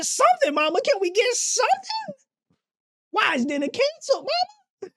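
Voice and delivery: put-on pleading kid. Kid begging mama for dinner.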